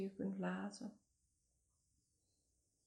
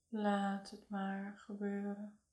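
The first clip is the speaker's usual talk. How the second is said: normal talking voice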